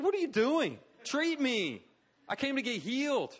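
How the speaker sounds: high, loud exasperated demand; indignant protest. Acted. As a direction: protest as a patient wanting treatment. Acted patient protests to useless doctor.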